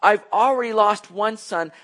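a father terrified of losing another son; panicked father. Acted. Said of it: "Jacob's freaking out".

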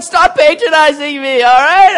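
high, loud mock wail of hurt pride; upset outburst. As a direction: snap at the patronizing saints, half crying. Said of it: "I'm like".

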